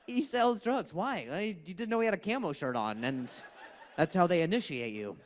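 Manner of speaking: ordinary delivery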